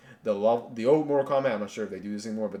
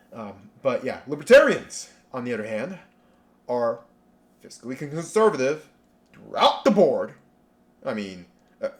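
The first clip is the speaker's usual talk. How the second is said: ordinary talk, nothing marked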